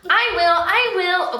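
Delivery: a hint of a child promising; character line. A narrator's reading. Mother reading Kara's reply to Ringo, lightly voiced. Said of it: "Kara says".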